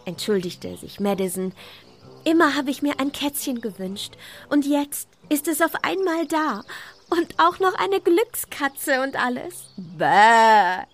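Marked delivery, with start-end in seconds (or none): 2.3-9.7 put-on high, eager girl's voice; Madison, thrilled about her kitten; excited little girl. Madison tells of her wish. 9.8-10.8 loud mock baa; sheep bleat. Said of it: "blökte die Schafsmama".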